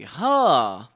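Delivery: usual speaking voice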